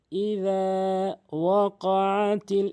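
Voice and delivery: very slow, word by word recitation; one by one. Shown as a demonstration. Teacher showing word-by-word reading, very slow. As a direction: say each word separately and slowly. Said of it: "একটি একটি করে বলবেন".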